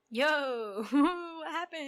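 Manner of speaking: high, slow bewildered question with a laugh; mock confused ghost. Friend imagines waking up dead, asking high and bewildered. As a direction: play the baffled newly dead.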